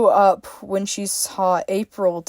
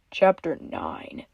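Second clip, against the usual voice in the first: ordinary delivery, nothing marked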